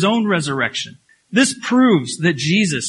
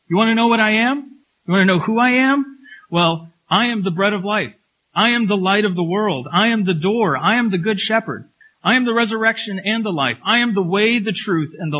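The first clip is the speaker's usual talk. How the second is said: rising preaching build; 4 semitones higher than usual. Acted.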